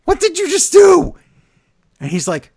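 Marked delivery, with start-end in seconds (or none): 0.0-1.1 much higher, louder shocked cry. Retold or imitated. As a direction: act stunned, voice jumping way up. Shocked brother quote, very high.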